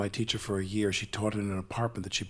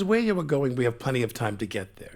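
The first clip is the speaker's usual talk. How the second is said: put-on Russian-accented line reading, higher at first; Russian-accented coach demonstrating the line; teacher's heavy accent; 4 semitones higher than usual. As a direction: show the gentler reading in her accent. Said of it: "obviously had a very heavy accent".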